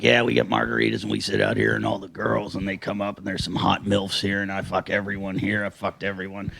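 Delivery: sleaze it out low under the breath; mock sleazy boast. Comedian imitating Craig bragging at the gym, low and soft. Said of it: "and he's like".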